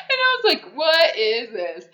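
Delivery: high loud laughing disbelief; stunned delight; cracking up in disbelief; shocked laugh. Real, not acted. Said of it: "laughing disbelief".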